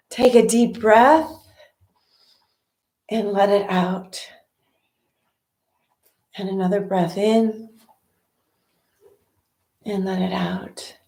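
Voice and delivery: calm breathing instructions, spaced out; guided meditation. Acted.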